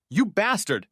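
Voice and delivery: wife shouting at her husband in jail. A narrator's reading. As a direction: scream the insult at him. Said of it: "she shouted".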